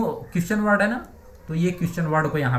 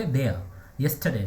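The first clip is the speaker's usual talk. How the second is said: usual speaking voice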